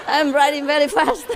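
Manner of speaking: punchline said again through laughter; laughing repeat. Real, not acted. Teller repeats the policeman's punchline, laughing. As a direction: chuckle the line back out. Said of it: "repeats punchline laughing".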